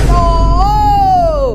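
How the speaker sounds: loud, high shout of oo; loud cry. Real, not acted. Vlogger agreeing loudly about the brothers' likeness, high loud shout. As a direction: yell it out in agreement.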